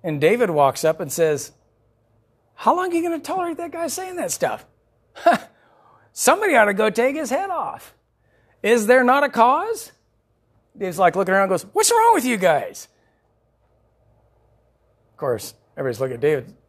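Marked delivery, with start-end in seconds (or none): 2.6-9.9 high, fired-up challenge; rally the cowards with youthful fire; indignant young David. Acted. David rebuking the frightened army. 11.7-12.9 high, loud disbelief; a boy baffled by frightened soldiers; exasperated young David. Acted.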